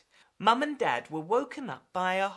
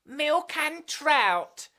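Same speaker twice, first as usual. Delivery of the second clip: higher, drawn-out order; slow order; 7 semitones higher, 4 dB louder, much slower than usual. Acted. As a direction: order slowly and grandly. Ordering as a cat.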